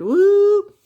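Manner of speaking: high, loud woo; excited whoop. Real, not acted. Teacher whoops about the entryway to womanhood. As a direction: cheer it out.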